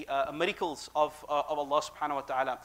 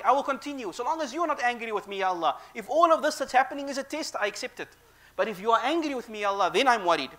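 louder pleading prayer; fervent prayer; 6 semitones higher, 6 dB louder than usual. Acted. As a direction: plead with full heart. Dua continued with fervour.

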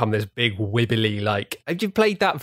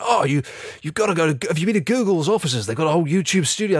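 higher, mock-posh eager gentleman; posh older man; 7 semitones higher than usual. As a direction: imitate a posh fifty-something enthusing. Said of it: "this, like, posh man in his fifties".

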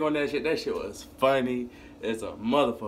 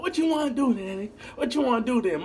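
challenging; 9 semitones higher, faster than usual. Retold or imitated. Squaring up with brother.

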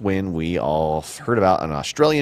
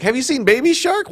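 high, louder little-nephew voice; imitating excited nephew; 6 dB louder than usual. Host quoting his nephew's question, mock excited kid. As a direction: mimic an eager kid, pitched way up. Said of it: "my nephew was totally into that".

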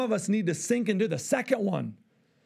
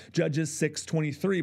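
ordinary talk

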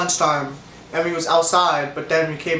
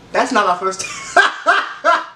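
slow, breaking into laughter on the last word; amused self-correction; laughing; 4 semitones higher, slower than usual. Real, not acted. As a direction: catch yourself and crack up. Realizes mistake mid-story.

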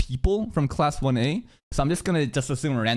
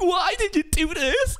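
mock crying; 12 semitones higher than usual. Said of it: "begging and crying, like".

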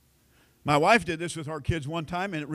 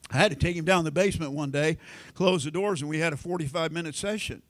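ordinary talk, nothing marked

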